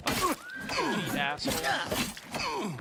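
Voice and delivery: high, slow, drawn-out curse; slow high curse at the enemy; dragged-out curse. Real, not acted. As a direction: stretch the insult out in disbelief. Raging at enemy during fight.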